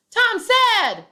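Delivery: "Tom said" yelled high and loud; wrong version: "Tom said" shouted; yelling the tag. Shown as a demonstration. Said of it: "which part was yelled".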